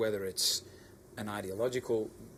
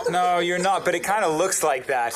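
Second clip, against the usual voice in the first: loud teasing denial; 7 semitones higher, 12 dB louder than usual. Real, not acted.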